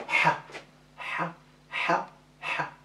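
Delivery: breathy ha from the throat; throaty ha. Shown as a demonstration. Teacher drilling the letter ha from the throat. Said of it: "Alors on voit ha".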